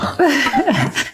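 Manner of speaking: laugh. Real, not acted. Laughing after the joke.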